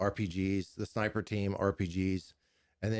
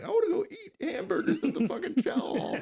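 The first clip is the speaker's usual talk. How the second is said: much higher, laughing whiny voice; mock whining soldiers; 14 semitones higher than usual. Soldiers whining for hamburgers at the chow hall, laughing high whine. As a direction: whine like tired kids who want burgers. Everyone whining about dinner.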